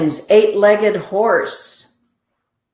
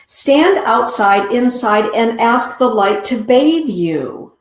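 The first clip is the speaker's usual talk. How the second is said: guiding invocation; 5 semitones higher, 3 dB louder than usual. Real, not acted. Oracle reader guiding listener.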